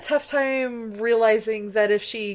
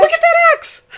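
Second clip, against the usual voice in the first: very high, loud holler; hammed-up high excitement; mock excited yell; 10 dB louder than usual. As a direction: shout it like a viewer at the screen. Both hosts yell at the screen.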